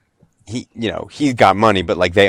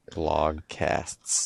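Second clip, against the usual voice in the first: slow and quiet, word drawn out; 3 semitones lower, 12 dB quieter than usual. Real, not acted. Trying out a weird new word, slowly and quietly. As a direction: draw the word out softly. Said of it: "you wanna say it slowly".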